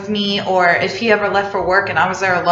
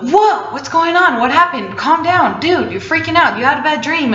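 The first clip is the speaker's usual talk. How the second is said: a higher, lightly voiced boyfriend saying calm down; a light mock of a worried partner, raised in pitch; boyfriend calming her; 6 semitones higher than usual. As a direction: imitate a concerned guy talking her down. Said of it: "and he was like".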